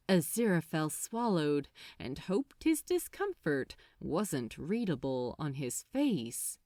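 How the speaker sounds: ordinary delivery, nothing marked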